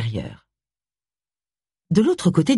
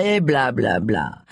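dismissive blah-blah; 5 semitones lower, slower than usual. Pretend. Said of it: "jouer les indignés".

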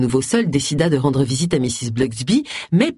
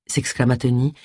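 plain reading voice

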